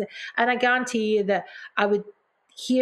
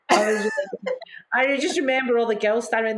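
ordinary talk

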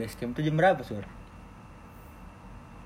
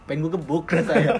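laughing; 4 semitones higher, 7 dB louder than usual. Real, not acted. Annoyed friend woke him up.

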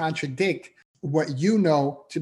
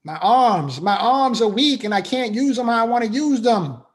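higher and louder, pained complaint; husband venting weak arms to his wife, pained and loud; complaining to wife; 5 semitones higher, 5 dB louder than usual. Retold or imitated. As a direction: cry out your frustration to your wife. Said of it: "And I'm like".

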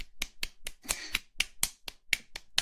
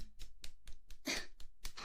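soft breathy giggle; 8 dB quieter than usual. Acted. Soft giggle during roleplay.

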